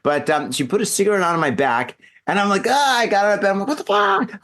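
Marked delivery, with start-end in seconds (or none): 2.7-3.0 pained yelp. Retold or imitated. Cigarette put out on back. 3.7-4.4 shocked. Retold or imitated. Retold waking in shock.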